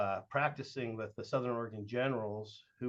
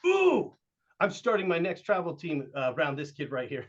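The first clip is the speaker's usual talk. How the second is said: excited coach; 5 semitones higher, 7 dB louder than usual. Retold or imitated. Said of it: "And Antonio goes".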